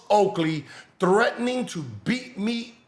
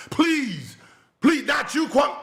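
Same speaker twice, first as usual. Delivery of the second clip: mock pleading; 7 semitones higher, slower than usual.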